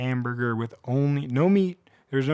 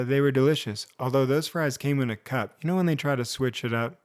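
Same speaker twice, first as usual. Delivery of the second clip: ordinary delivery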